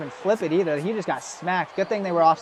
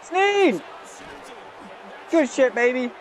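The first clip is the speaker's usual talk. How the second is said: high, drawn-out shout of a name; excited cheer; 6 semitones higher, slower than usual. Real, not acted. Streamer cheering on a player mid-game, excited cheer. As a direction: cry the name out high in celebration.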